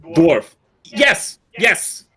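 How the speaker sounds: high, punched one-word answers; eager mimic bird. Bird character confirming the orc's guess, high eager outbursts.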